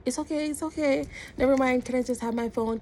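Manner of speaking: high-pitched, hurried-to-appease retold plea; anxious placating. Retold anxious placating to get phone.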